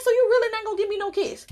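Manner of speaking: high, fast pleading breaking into laughter; laughing mock plea. To daddy refusing a kiss, high laughing plea. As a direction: beg then crack up.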